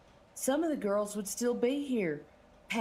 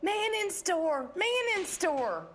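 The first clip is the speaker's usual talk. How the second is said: high-pitched alarm call; 9 semitones higher than usual. Acted. Shopworker alerting everyone that men walked in, calling out high. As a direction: sing out a warning to the shop, high.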